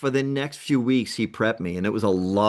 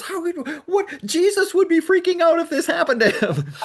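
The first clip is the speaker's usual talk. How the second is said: shocked shout; 5 dB louder than usual. Retold or imitated. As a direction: shriek in disbelief at his calm. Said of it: "He goes".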